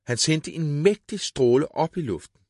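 plain reading voice

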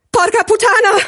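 high, strained yell of alarm; screamed curse. A narrator's reading. Gigi sees the car closing in, screaming. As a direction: scream the swear word in panic. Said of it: "schrie Gigi".

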